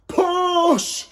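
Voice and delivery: high, loud shouted push; crowd shout. A narrator's reading. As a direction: yell it from the crowd. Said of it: "shouted the crowd".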